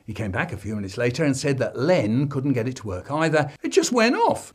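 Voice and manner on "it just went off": put-on high whiny kid voice; boy pleading innocence to his dad; child voice. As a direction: squeak it out like a guilty little boy. The refrain again.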